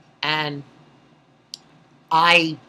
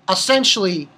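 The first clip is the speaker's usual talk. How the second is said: normal talking voice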